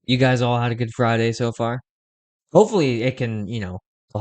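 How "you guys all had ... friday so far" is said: usual speaking voice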